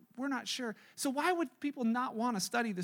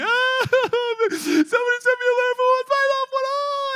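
much higher, louder, hammed-up weeping voice; mock bawling; 13 semitones higher, 10 dB louder than usual. Preacher imitating a Survivor contestant bawling over a letter, high and loud. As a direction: wail it like a big burly guy crying. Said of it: "bawling their eyes out".

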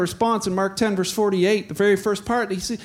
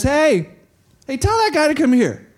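higher, louder summons; calling out; 5 semitones higher, 4 dB louder than usual. Acted. Jesus stops and summons the beggar, calling out. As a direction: call across a crowd. Jesus calls over the crowd.

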